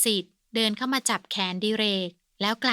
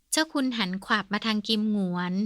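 ordinary delivery

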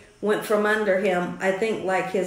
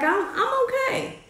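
lightly mimicked reply, pitched way up; imitated husband; 3 dB quieter than usual. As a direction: quote him in a high, reassuring squeak. Imitating husband's answer after injury.

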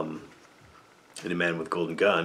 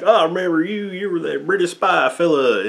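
mock Southern sheriff; 9 semitones higher, 4 dB louder than usual. Sheriff recognizing Bond on the boat, hammed-up Southern voice. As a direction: play the loudmouth sheriff spotting Bond.

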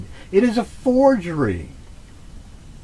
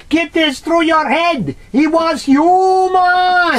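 insistent; 8 semitones higher, 3 dB louder, slower than usual. Real, not acted. Insisting loudly in argument.

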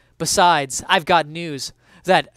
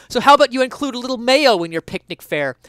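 higher, louder needling question; mock challenge; 7 semitones higher, 8 dB louder, faster than usual. Essayist challenges young people about picnics, higher and louder. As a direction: goad the kids.

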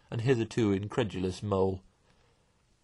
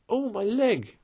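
high-pitched cry of pain; pained squeal; 12 semitones higher, 3 dB louder, slower than usual. A narrator's reading. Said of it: "fell forward on his face with a squeal".

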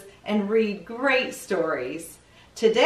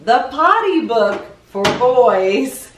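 announcing title; 5 dB louder than usual. Acted. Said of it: "something's just arrived".

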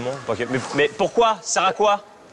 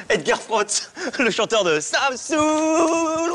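excited fan; 11 semitones higher, much slower than usual. Real, not acted. Fan spots the star.

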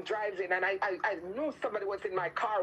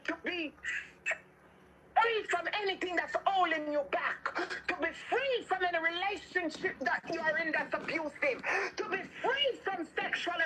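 high, insistent, repetitive prayer cadence; fervent deliverance prayer; 6 semitones higher than usual. Real, not acted. Preacher praying listeners free from bondage, fervent deliverance. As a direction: push each plea out with urgency.

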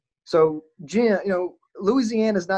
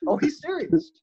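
surprised realization; 9 semitones higher than usual. Retold or imitated. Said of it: "I'm sitting there going".

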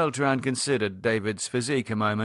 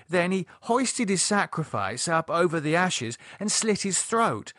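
higher-pitched, animated storyteller; Lydia recounting gleefully; 7 semitones higher than usual. Acted. Lydia telling Beltran about an old Dracula film, animated. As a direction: relish every gory detail of the scene.

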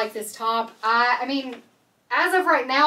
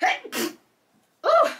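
cough and squeal; 5 dB louder than usual. Real, not acted.

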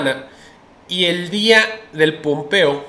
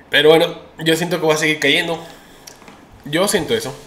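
usual speaking voice

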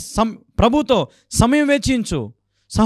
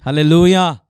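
called-out hallelujah; 4 semitones lower, 5 dB louder than usual. Real, not acted. Preacher calls out to congregation.

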